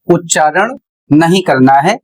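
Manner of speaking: ordinary talk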